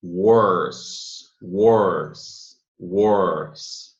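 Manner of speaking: worse dying out on the S sound; ending on s. Shown as a demonstration. Teacher drilling worse for students. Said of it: "morre no som do S".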